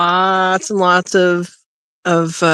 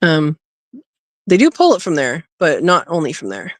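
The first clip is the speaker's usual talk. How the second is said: ordinary delivery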